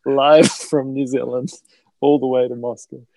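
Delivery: mock announcer. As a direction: hype it like a live broadcast.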